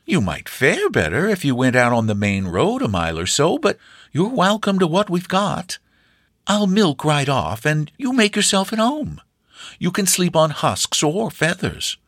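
put-on high, hospitable old farm woman; high-pitched gracious granny; gracious old hostess. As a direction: welcome the guest generously. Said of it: "she proffered graciously".

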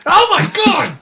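high, loud cry of exasperation; exasperated outburst. Real, not acted. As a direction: explode at a friend's pompous wording. Exasperated by friend's vocabulary.

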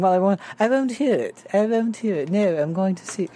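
mock stubborn refusal. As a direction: pitch up and dig in, half-joking. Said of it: "And she kept saying".

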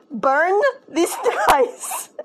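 can't keep a straight face retelling grandma's line; laughing through a quote. Retold or imitated. Vlogger retells grandma slamming the computer, laughing.

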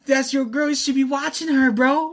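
put-on very high drunken taunt; drunken imitation. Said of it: "Drunkenly, like".

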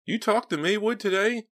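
high, tight frightened voice; loud high-pitched question, throat tight with fear; choked, scared. A narrator's reading. Nervous robber asking from the back seat, choked and scared. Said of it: "his voice sounding choked and scared".

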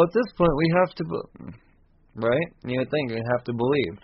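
ordinary talk

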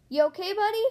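loud, high rising question; calling out. A narrator's reading. Lightning checking on Mater in darkness, calling out. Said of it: "Lightning called into the darkness".

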